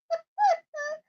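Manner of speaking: sudden high loud giggle; a high cackle breaking through the quote; high burst of laughter. Real, not acted. Laughing at own impression.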